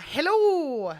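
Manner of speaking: drawn-out mock Irish greeting; attempted Irish accent. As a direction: stretch it out in a put-on Irish lilt. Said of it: "oh, Ryanair".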